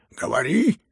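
loud, rising command; frenzied roar. A narrator's reading. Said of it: "ревел генерал в совершенном исступлении".

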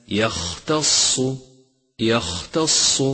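يختص slowly, with an airy voiceless خ; breathy-release Kha. Shown as a demonstration. Teacher modelling يختص, airy voiceless خ. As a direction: let breath flow through the خ in يختص. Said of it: "لاحظ صفة الهمس لحرف الخاء".

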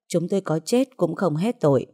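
ordinary delivery

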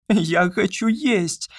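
high, slow, otherworldly; slow strange high voice. A narrator's reading. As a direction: drag it out high and eerie. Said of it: "наконец сказала она".

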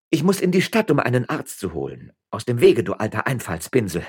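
loud, high-pitched brush-off; impatient command. A narrator's reading. Woman orders the old bailiff aside, impatient shout. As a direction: push past him with an urgent insult.

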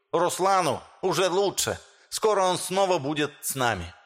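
high, lisping speech with sh for s. Acted. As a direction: lisp through a rousing public speech. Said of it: "По шепелявому голосу".

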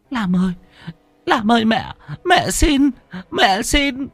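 high, wailing mother's plea, slowing at the end; heartrending pleading. A narrator's reading. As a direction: beg your son as your strength runs out. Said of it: "âm thanh cầu xin của mẹ thằng Lam thống thiết".

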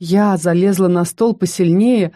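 usual speaking voice

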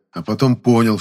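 usual speaking voice

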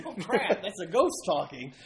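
high, loud, laughing "oh crap"; laughing mock alarm. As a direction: laugh through a mock startled cry. Retelling guests' shocked reaction.

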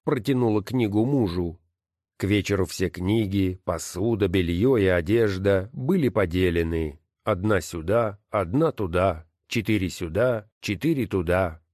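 ordinary delivery, nothing marked

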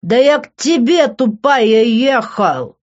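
loud, high, aggrieved shout; slurred, blaring protest; drunken yelling. A narrator's reading. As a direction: yell it down the phone. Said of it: "барабанные перепонки лопнут от этого звука".

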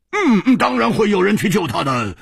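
high, emphatic reassurance to oneself; trying hard to believe it; self-convincing insistence. A narrator's reading. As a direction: insist on it, pitched well up. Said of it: "把这一句话接连说了好几遍".

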